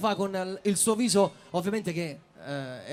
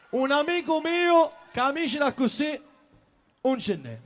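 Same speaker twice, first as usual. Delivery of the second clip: Palermitan dialect; 6 semitones higher than usual. Acted. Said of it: "dicono palermitano proprio stretto proprio".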